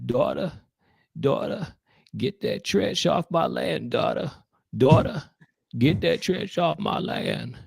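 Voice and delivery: drawn-out father calling his daughter; Walter Brennan. Acted. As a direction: drawl it like an old rancher. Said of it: "it sounds a little more like this".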